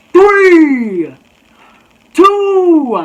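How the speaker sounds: high, loud, drawn-out numbers; mock countdown yell. Tiny girl nears end of countdown, high mock yell. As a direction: imitate her hollering out the count.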